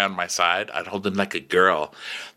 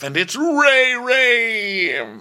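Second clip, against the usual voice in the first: high, long drawn-out screech on "Ray Ray"; dragon cry; 3 dB louder, much slower than usual. Retold or imitated. Podcaster imitating the arriving dragon, high cry. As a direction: stretch it high like a creature call. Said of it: "then a dragon flies up".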